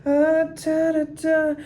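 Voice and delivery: half sing, half tell it up high; melodic storytelling. Shown as a demonstration. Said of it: "be very dramatic".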